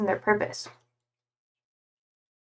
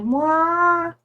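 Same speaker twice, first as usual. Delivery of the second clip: high camel cry; imitated camel bleat; 5 semitones higher than usual. Streamer repeating the sound camels make, imitated bleat. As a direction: give a drawn-out camel noise. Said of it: "That's like the sound they make".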